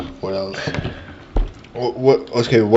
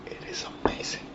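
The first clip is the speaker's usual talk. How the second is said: whispered in awe; whispering; 19 dB quieter, faster than usual. Real, not acted. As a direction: breathe it out, amazed. Savoring beer, hushed.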